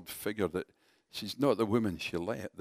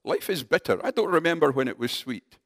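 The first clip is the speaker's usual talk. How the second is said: higher, louder bitter complaint; bitter outburst; 5 semitones higher, 5 dB louder than usual. Retold or imitated. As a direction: spit out her grief at life. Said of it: "she explodes and says".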